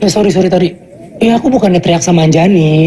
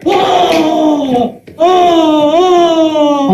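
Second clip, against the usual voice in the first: high, hammed-up yelps of pretend fear; mock fright; 7 semitones higher, much slower than usual. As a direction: yelp like a giant hit by magic dust. Father acts scared of magic dust.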